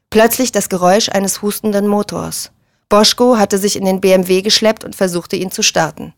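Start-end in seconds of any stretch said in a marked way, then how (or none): none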